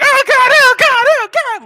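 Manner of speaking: very high, loud panicked shriek; hammed-up squealing cries for mercy; panicked wailing. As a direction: wail as if being beaten with a pan. Acts boy being hit with pan.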